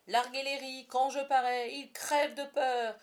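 swagger it out like Hook; pirate captain boast. A narrator's reading. Hook boasts to his crew, hearty and swaggering.

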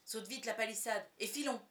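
urgent, emphatic order; urgent change of tone. A narrator's reading. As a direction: press the listener to flee now. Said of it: "sur un ton radicalement différent".

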